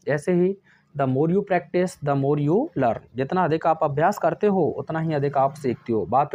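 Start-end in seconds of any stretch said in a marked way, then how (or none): 0.9-3.0 each word slow and spaced for copying; slow dictation. Shown as a demonstration. Teacher dictating an example sentence, slow and deliberate. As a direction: dictate it slowly so the class can write.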